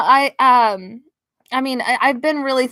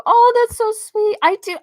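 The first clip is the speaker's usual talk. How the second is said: touched gushing; 13 semitones higher, 3 dB louder than usual. Real, not acted. To a viewer offering a drawing for her daughter, touched gushing. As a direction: coo it, deeply touched.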